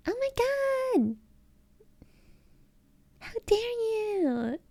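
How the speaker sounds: squeaky, very high and slow; old excited baby voice. Shown as a demonstration. Streamer shows chat how she used to talk, squealing very high. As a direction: squeal it way up high, dragging the words. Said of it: "do you guys wanna see how I used to talk".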